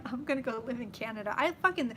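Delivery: usual speaking voice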